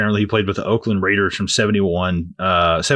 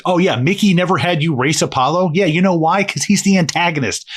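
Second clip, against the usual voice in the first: high-pitched, exasperated comeback; sarcastic mock retort; 7 semitones higher than usual. Podcaster mocking a dumb movie line, sarcastic retort. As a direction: snap back sarcastically, pitched up.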